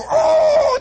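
high, loud laughing oh of shock; high, loud surprised yelp; startled cop cry. Retold or imitated. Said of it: "The cops go".

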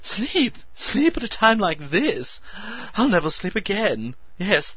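high squeaking excitement; squeaky hysteria. A narrator's reading. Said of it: "he squeaked".

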